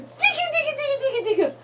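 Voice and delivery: sing-song high tickle voice; high squeaky tickle. Real, not acted. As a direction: squeak it playfully. Tickling babies.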